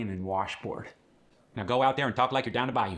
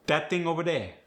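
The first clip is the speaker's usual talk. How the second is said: dat ting over dere in Cajun accent; 5 semitones higher than usual. Shown as a demonstration. Coach contrasting plain and Cajun accent. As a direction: drop the TH sounds, Cajun style. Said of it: "get the D".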